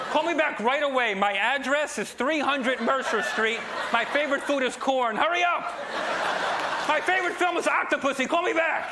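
high, excited rambling message; hyper voicemail. Pretend. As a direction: rattle off the message eagerly. Silly answering machine message.

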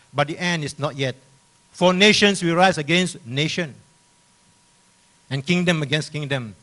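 loud, emphatic pulpit reading; forceful scripture proclamation. Acted. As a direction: sound the warning to the congregation.

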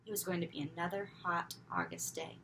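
usual speaking voice

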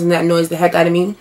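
ordinary talk

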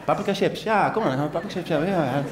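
high, quick sing-song mum voice; mother and child. Retold or imitated. Mother cheerfully agreeing with her child, lightly voiced. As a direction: hurry the toddler along cheerfully. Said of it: "Zij deed dit".

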